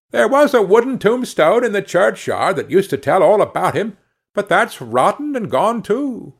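put-on high, piping old man; thin piping voice. A narrator's reading. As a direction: pipe it up thinly like an old man. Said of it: "replied in a thin piping voice".

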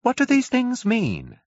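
high, slow, bewildered curate; dazed curate. A narrator's reading. Curate to the narrator, bewildered and high. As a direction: ask it slowly, lost and high. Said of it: "He spoke abruptly".

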